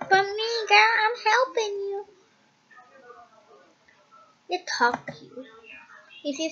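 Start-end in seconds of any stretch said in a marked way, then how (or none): 0.1-2.1 high, near-tearful plea; high crying insistence. Acted. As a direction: beg through tears. Pleading with bully.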